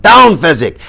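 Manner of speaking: urgent high shouted order; loud, high command; Inigo yelling. A narrator's reading. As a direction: yell a warning to get down. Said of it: "and he yelled".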